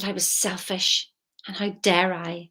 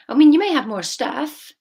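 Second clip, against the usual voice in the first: emphatic; 7 semitones higher, 9 dB louder than usual. Real, not acted. Sudden outburst.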